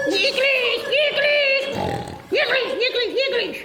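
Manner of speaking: mock high flirting priest; falsetto come-on. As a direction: squeak it out like a lovesick old fool.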